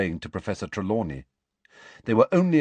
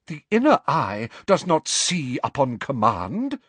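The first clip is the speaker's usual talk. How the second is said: louder, slow, offended proclamation; scandalized; 6 semitones higher, 5 dB louder than usual. A narrator's reading. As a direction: declare it with wounded dignity. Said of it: "in scandalized tones".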